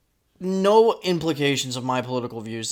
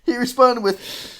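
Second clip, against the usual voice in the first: laughing; 8 semitones higher than usual. Real, not acted. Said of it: "I'm crying so much right now".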